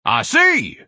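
very high, loud shriek; scream. A narrator's reading. Ignatius screams at the spokeswoman. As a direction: scream the discovery. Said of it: "Ignatius screamed".

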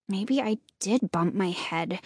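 low-volume, doubtful mumble; hushed, unsure admission; muttered. A narrator's reading. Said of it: "Hannah muttered".

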